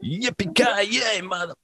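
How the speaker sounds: high, stretched-out cry, cut off short; playful high holler at half volume; mock cowboy whoop. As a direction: draw it out, then drop away before the swear. Re-enacts the phrase, cuts it off.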